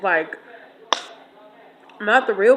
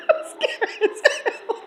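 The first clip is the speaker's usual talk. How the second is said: words breaking up into high laughter; laughing; 13 semitones higher, 6 dB quieter, slower than usual. Real, not acted. Storyteller looks back on her goose scare, laughing hard. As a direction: slowly squeeze it out while cracking up.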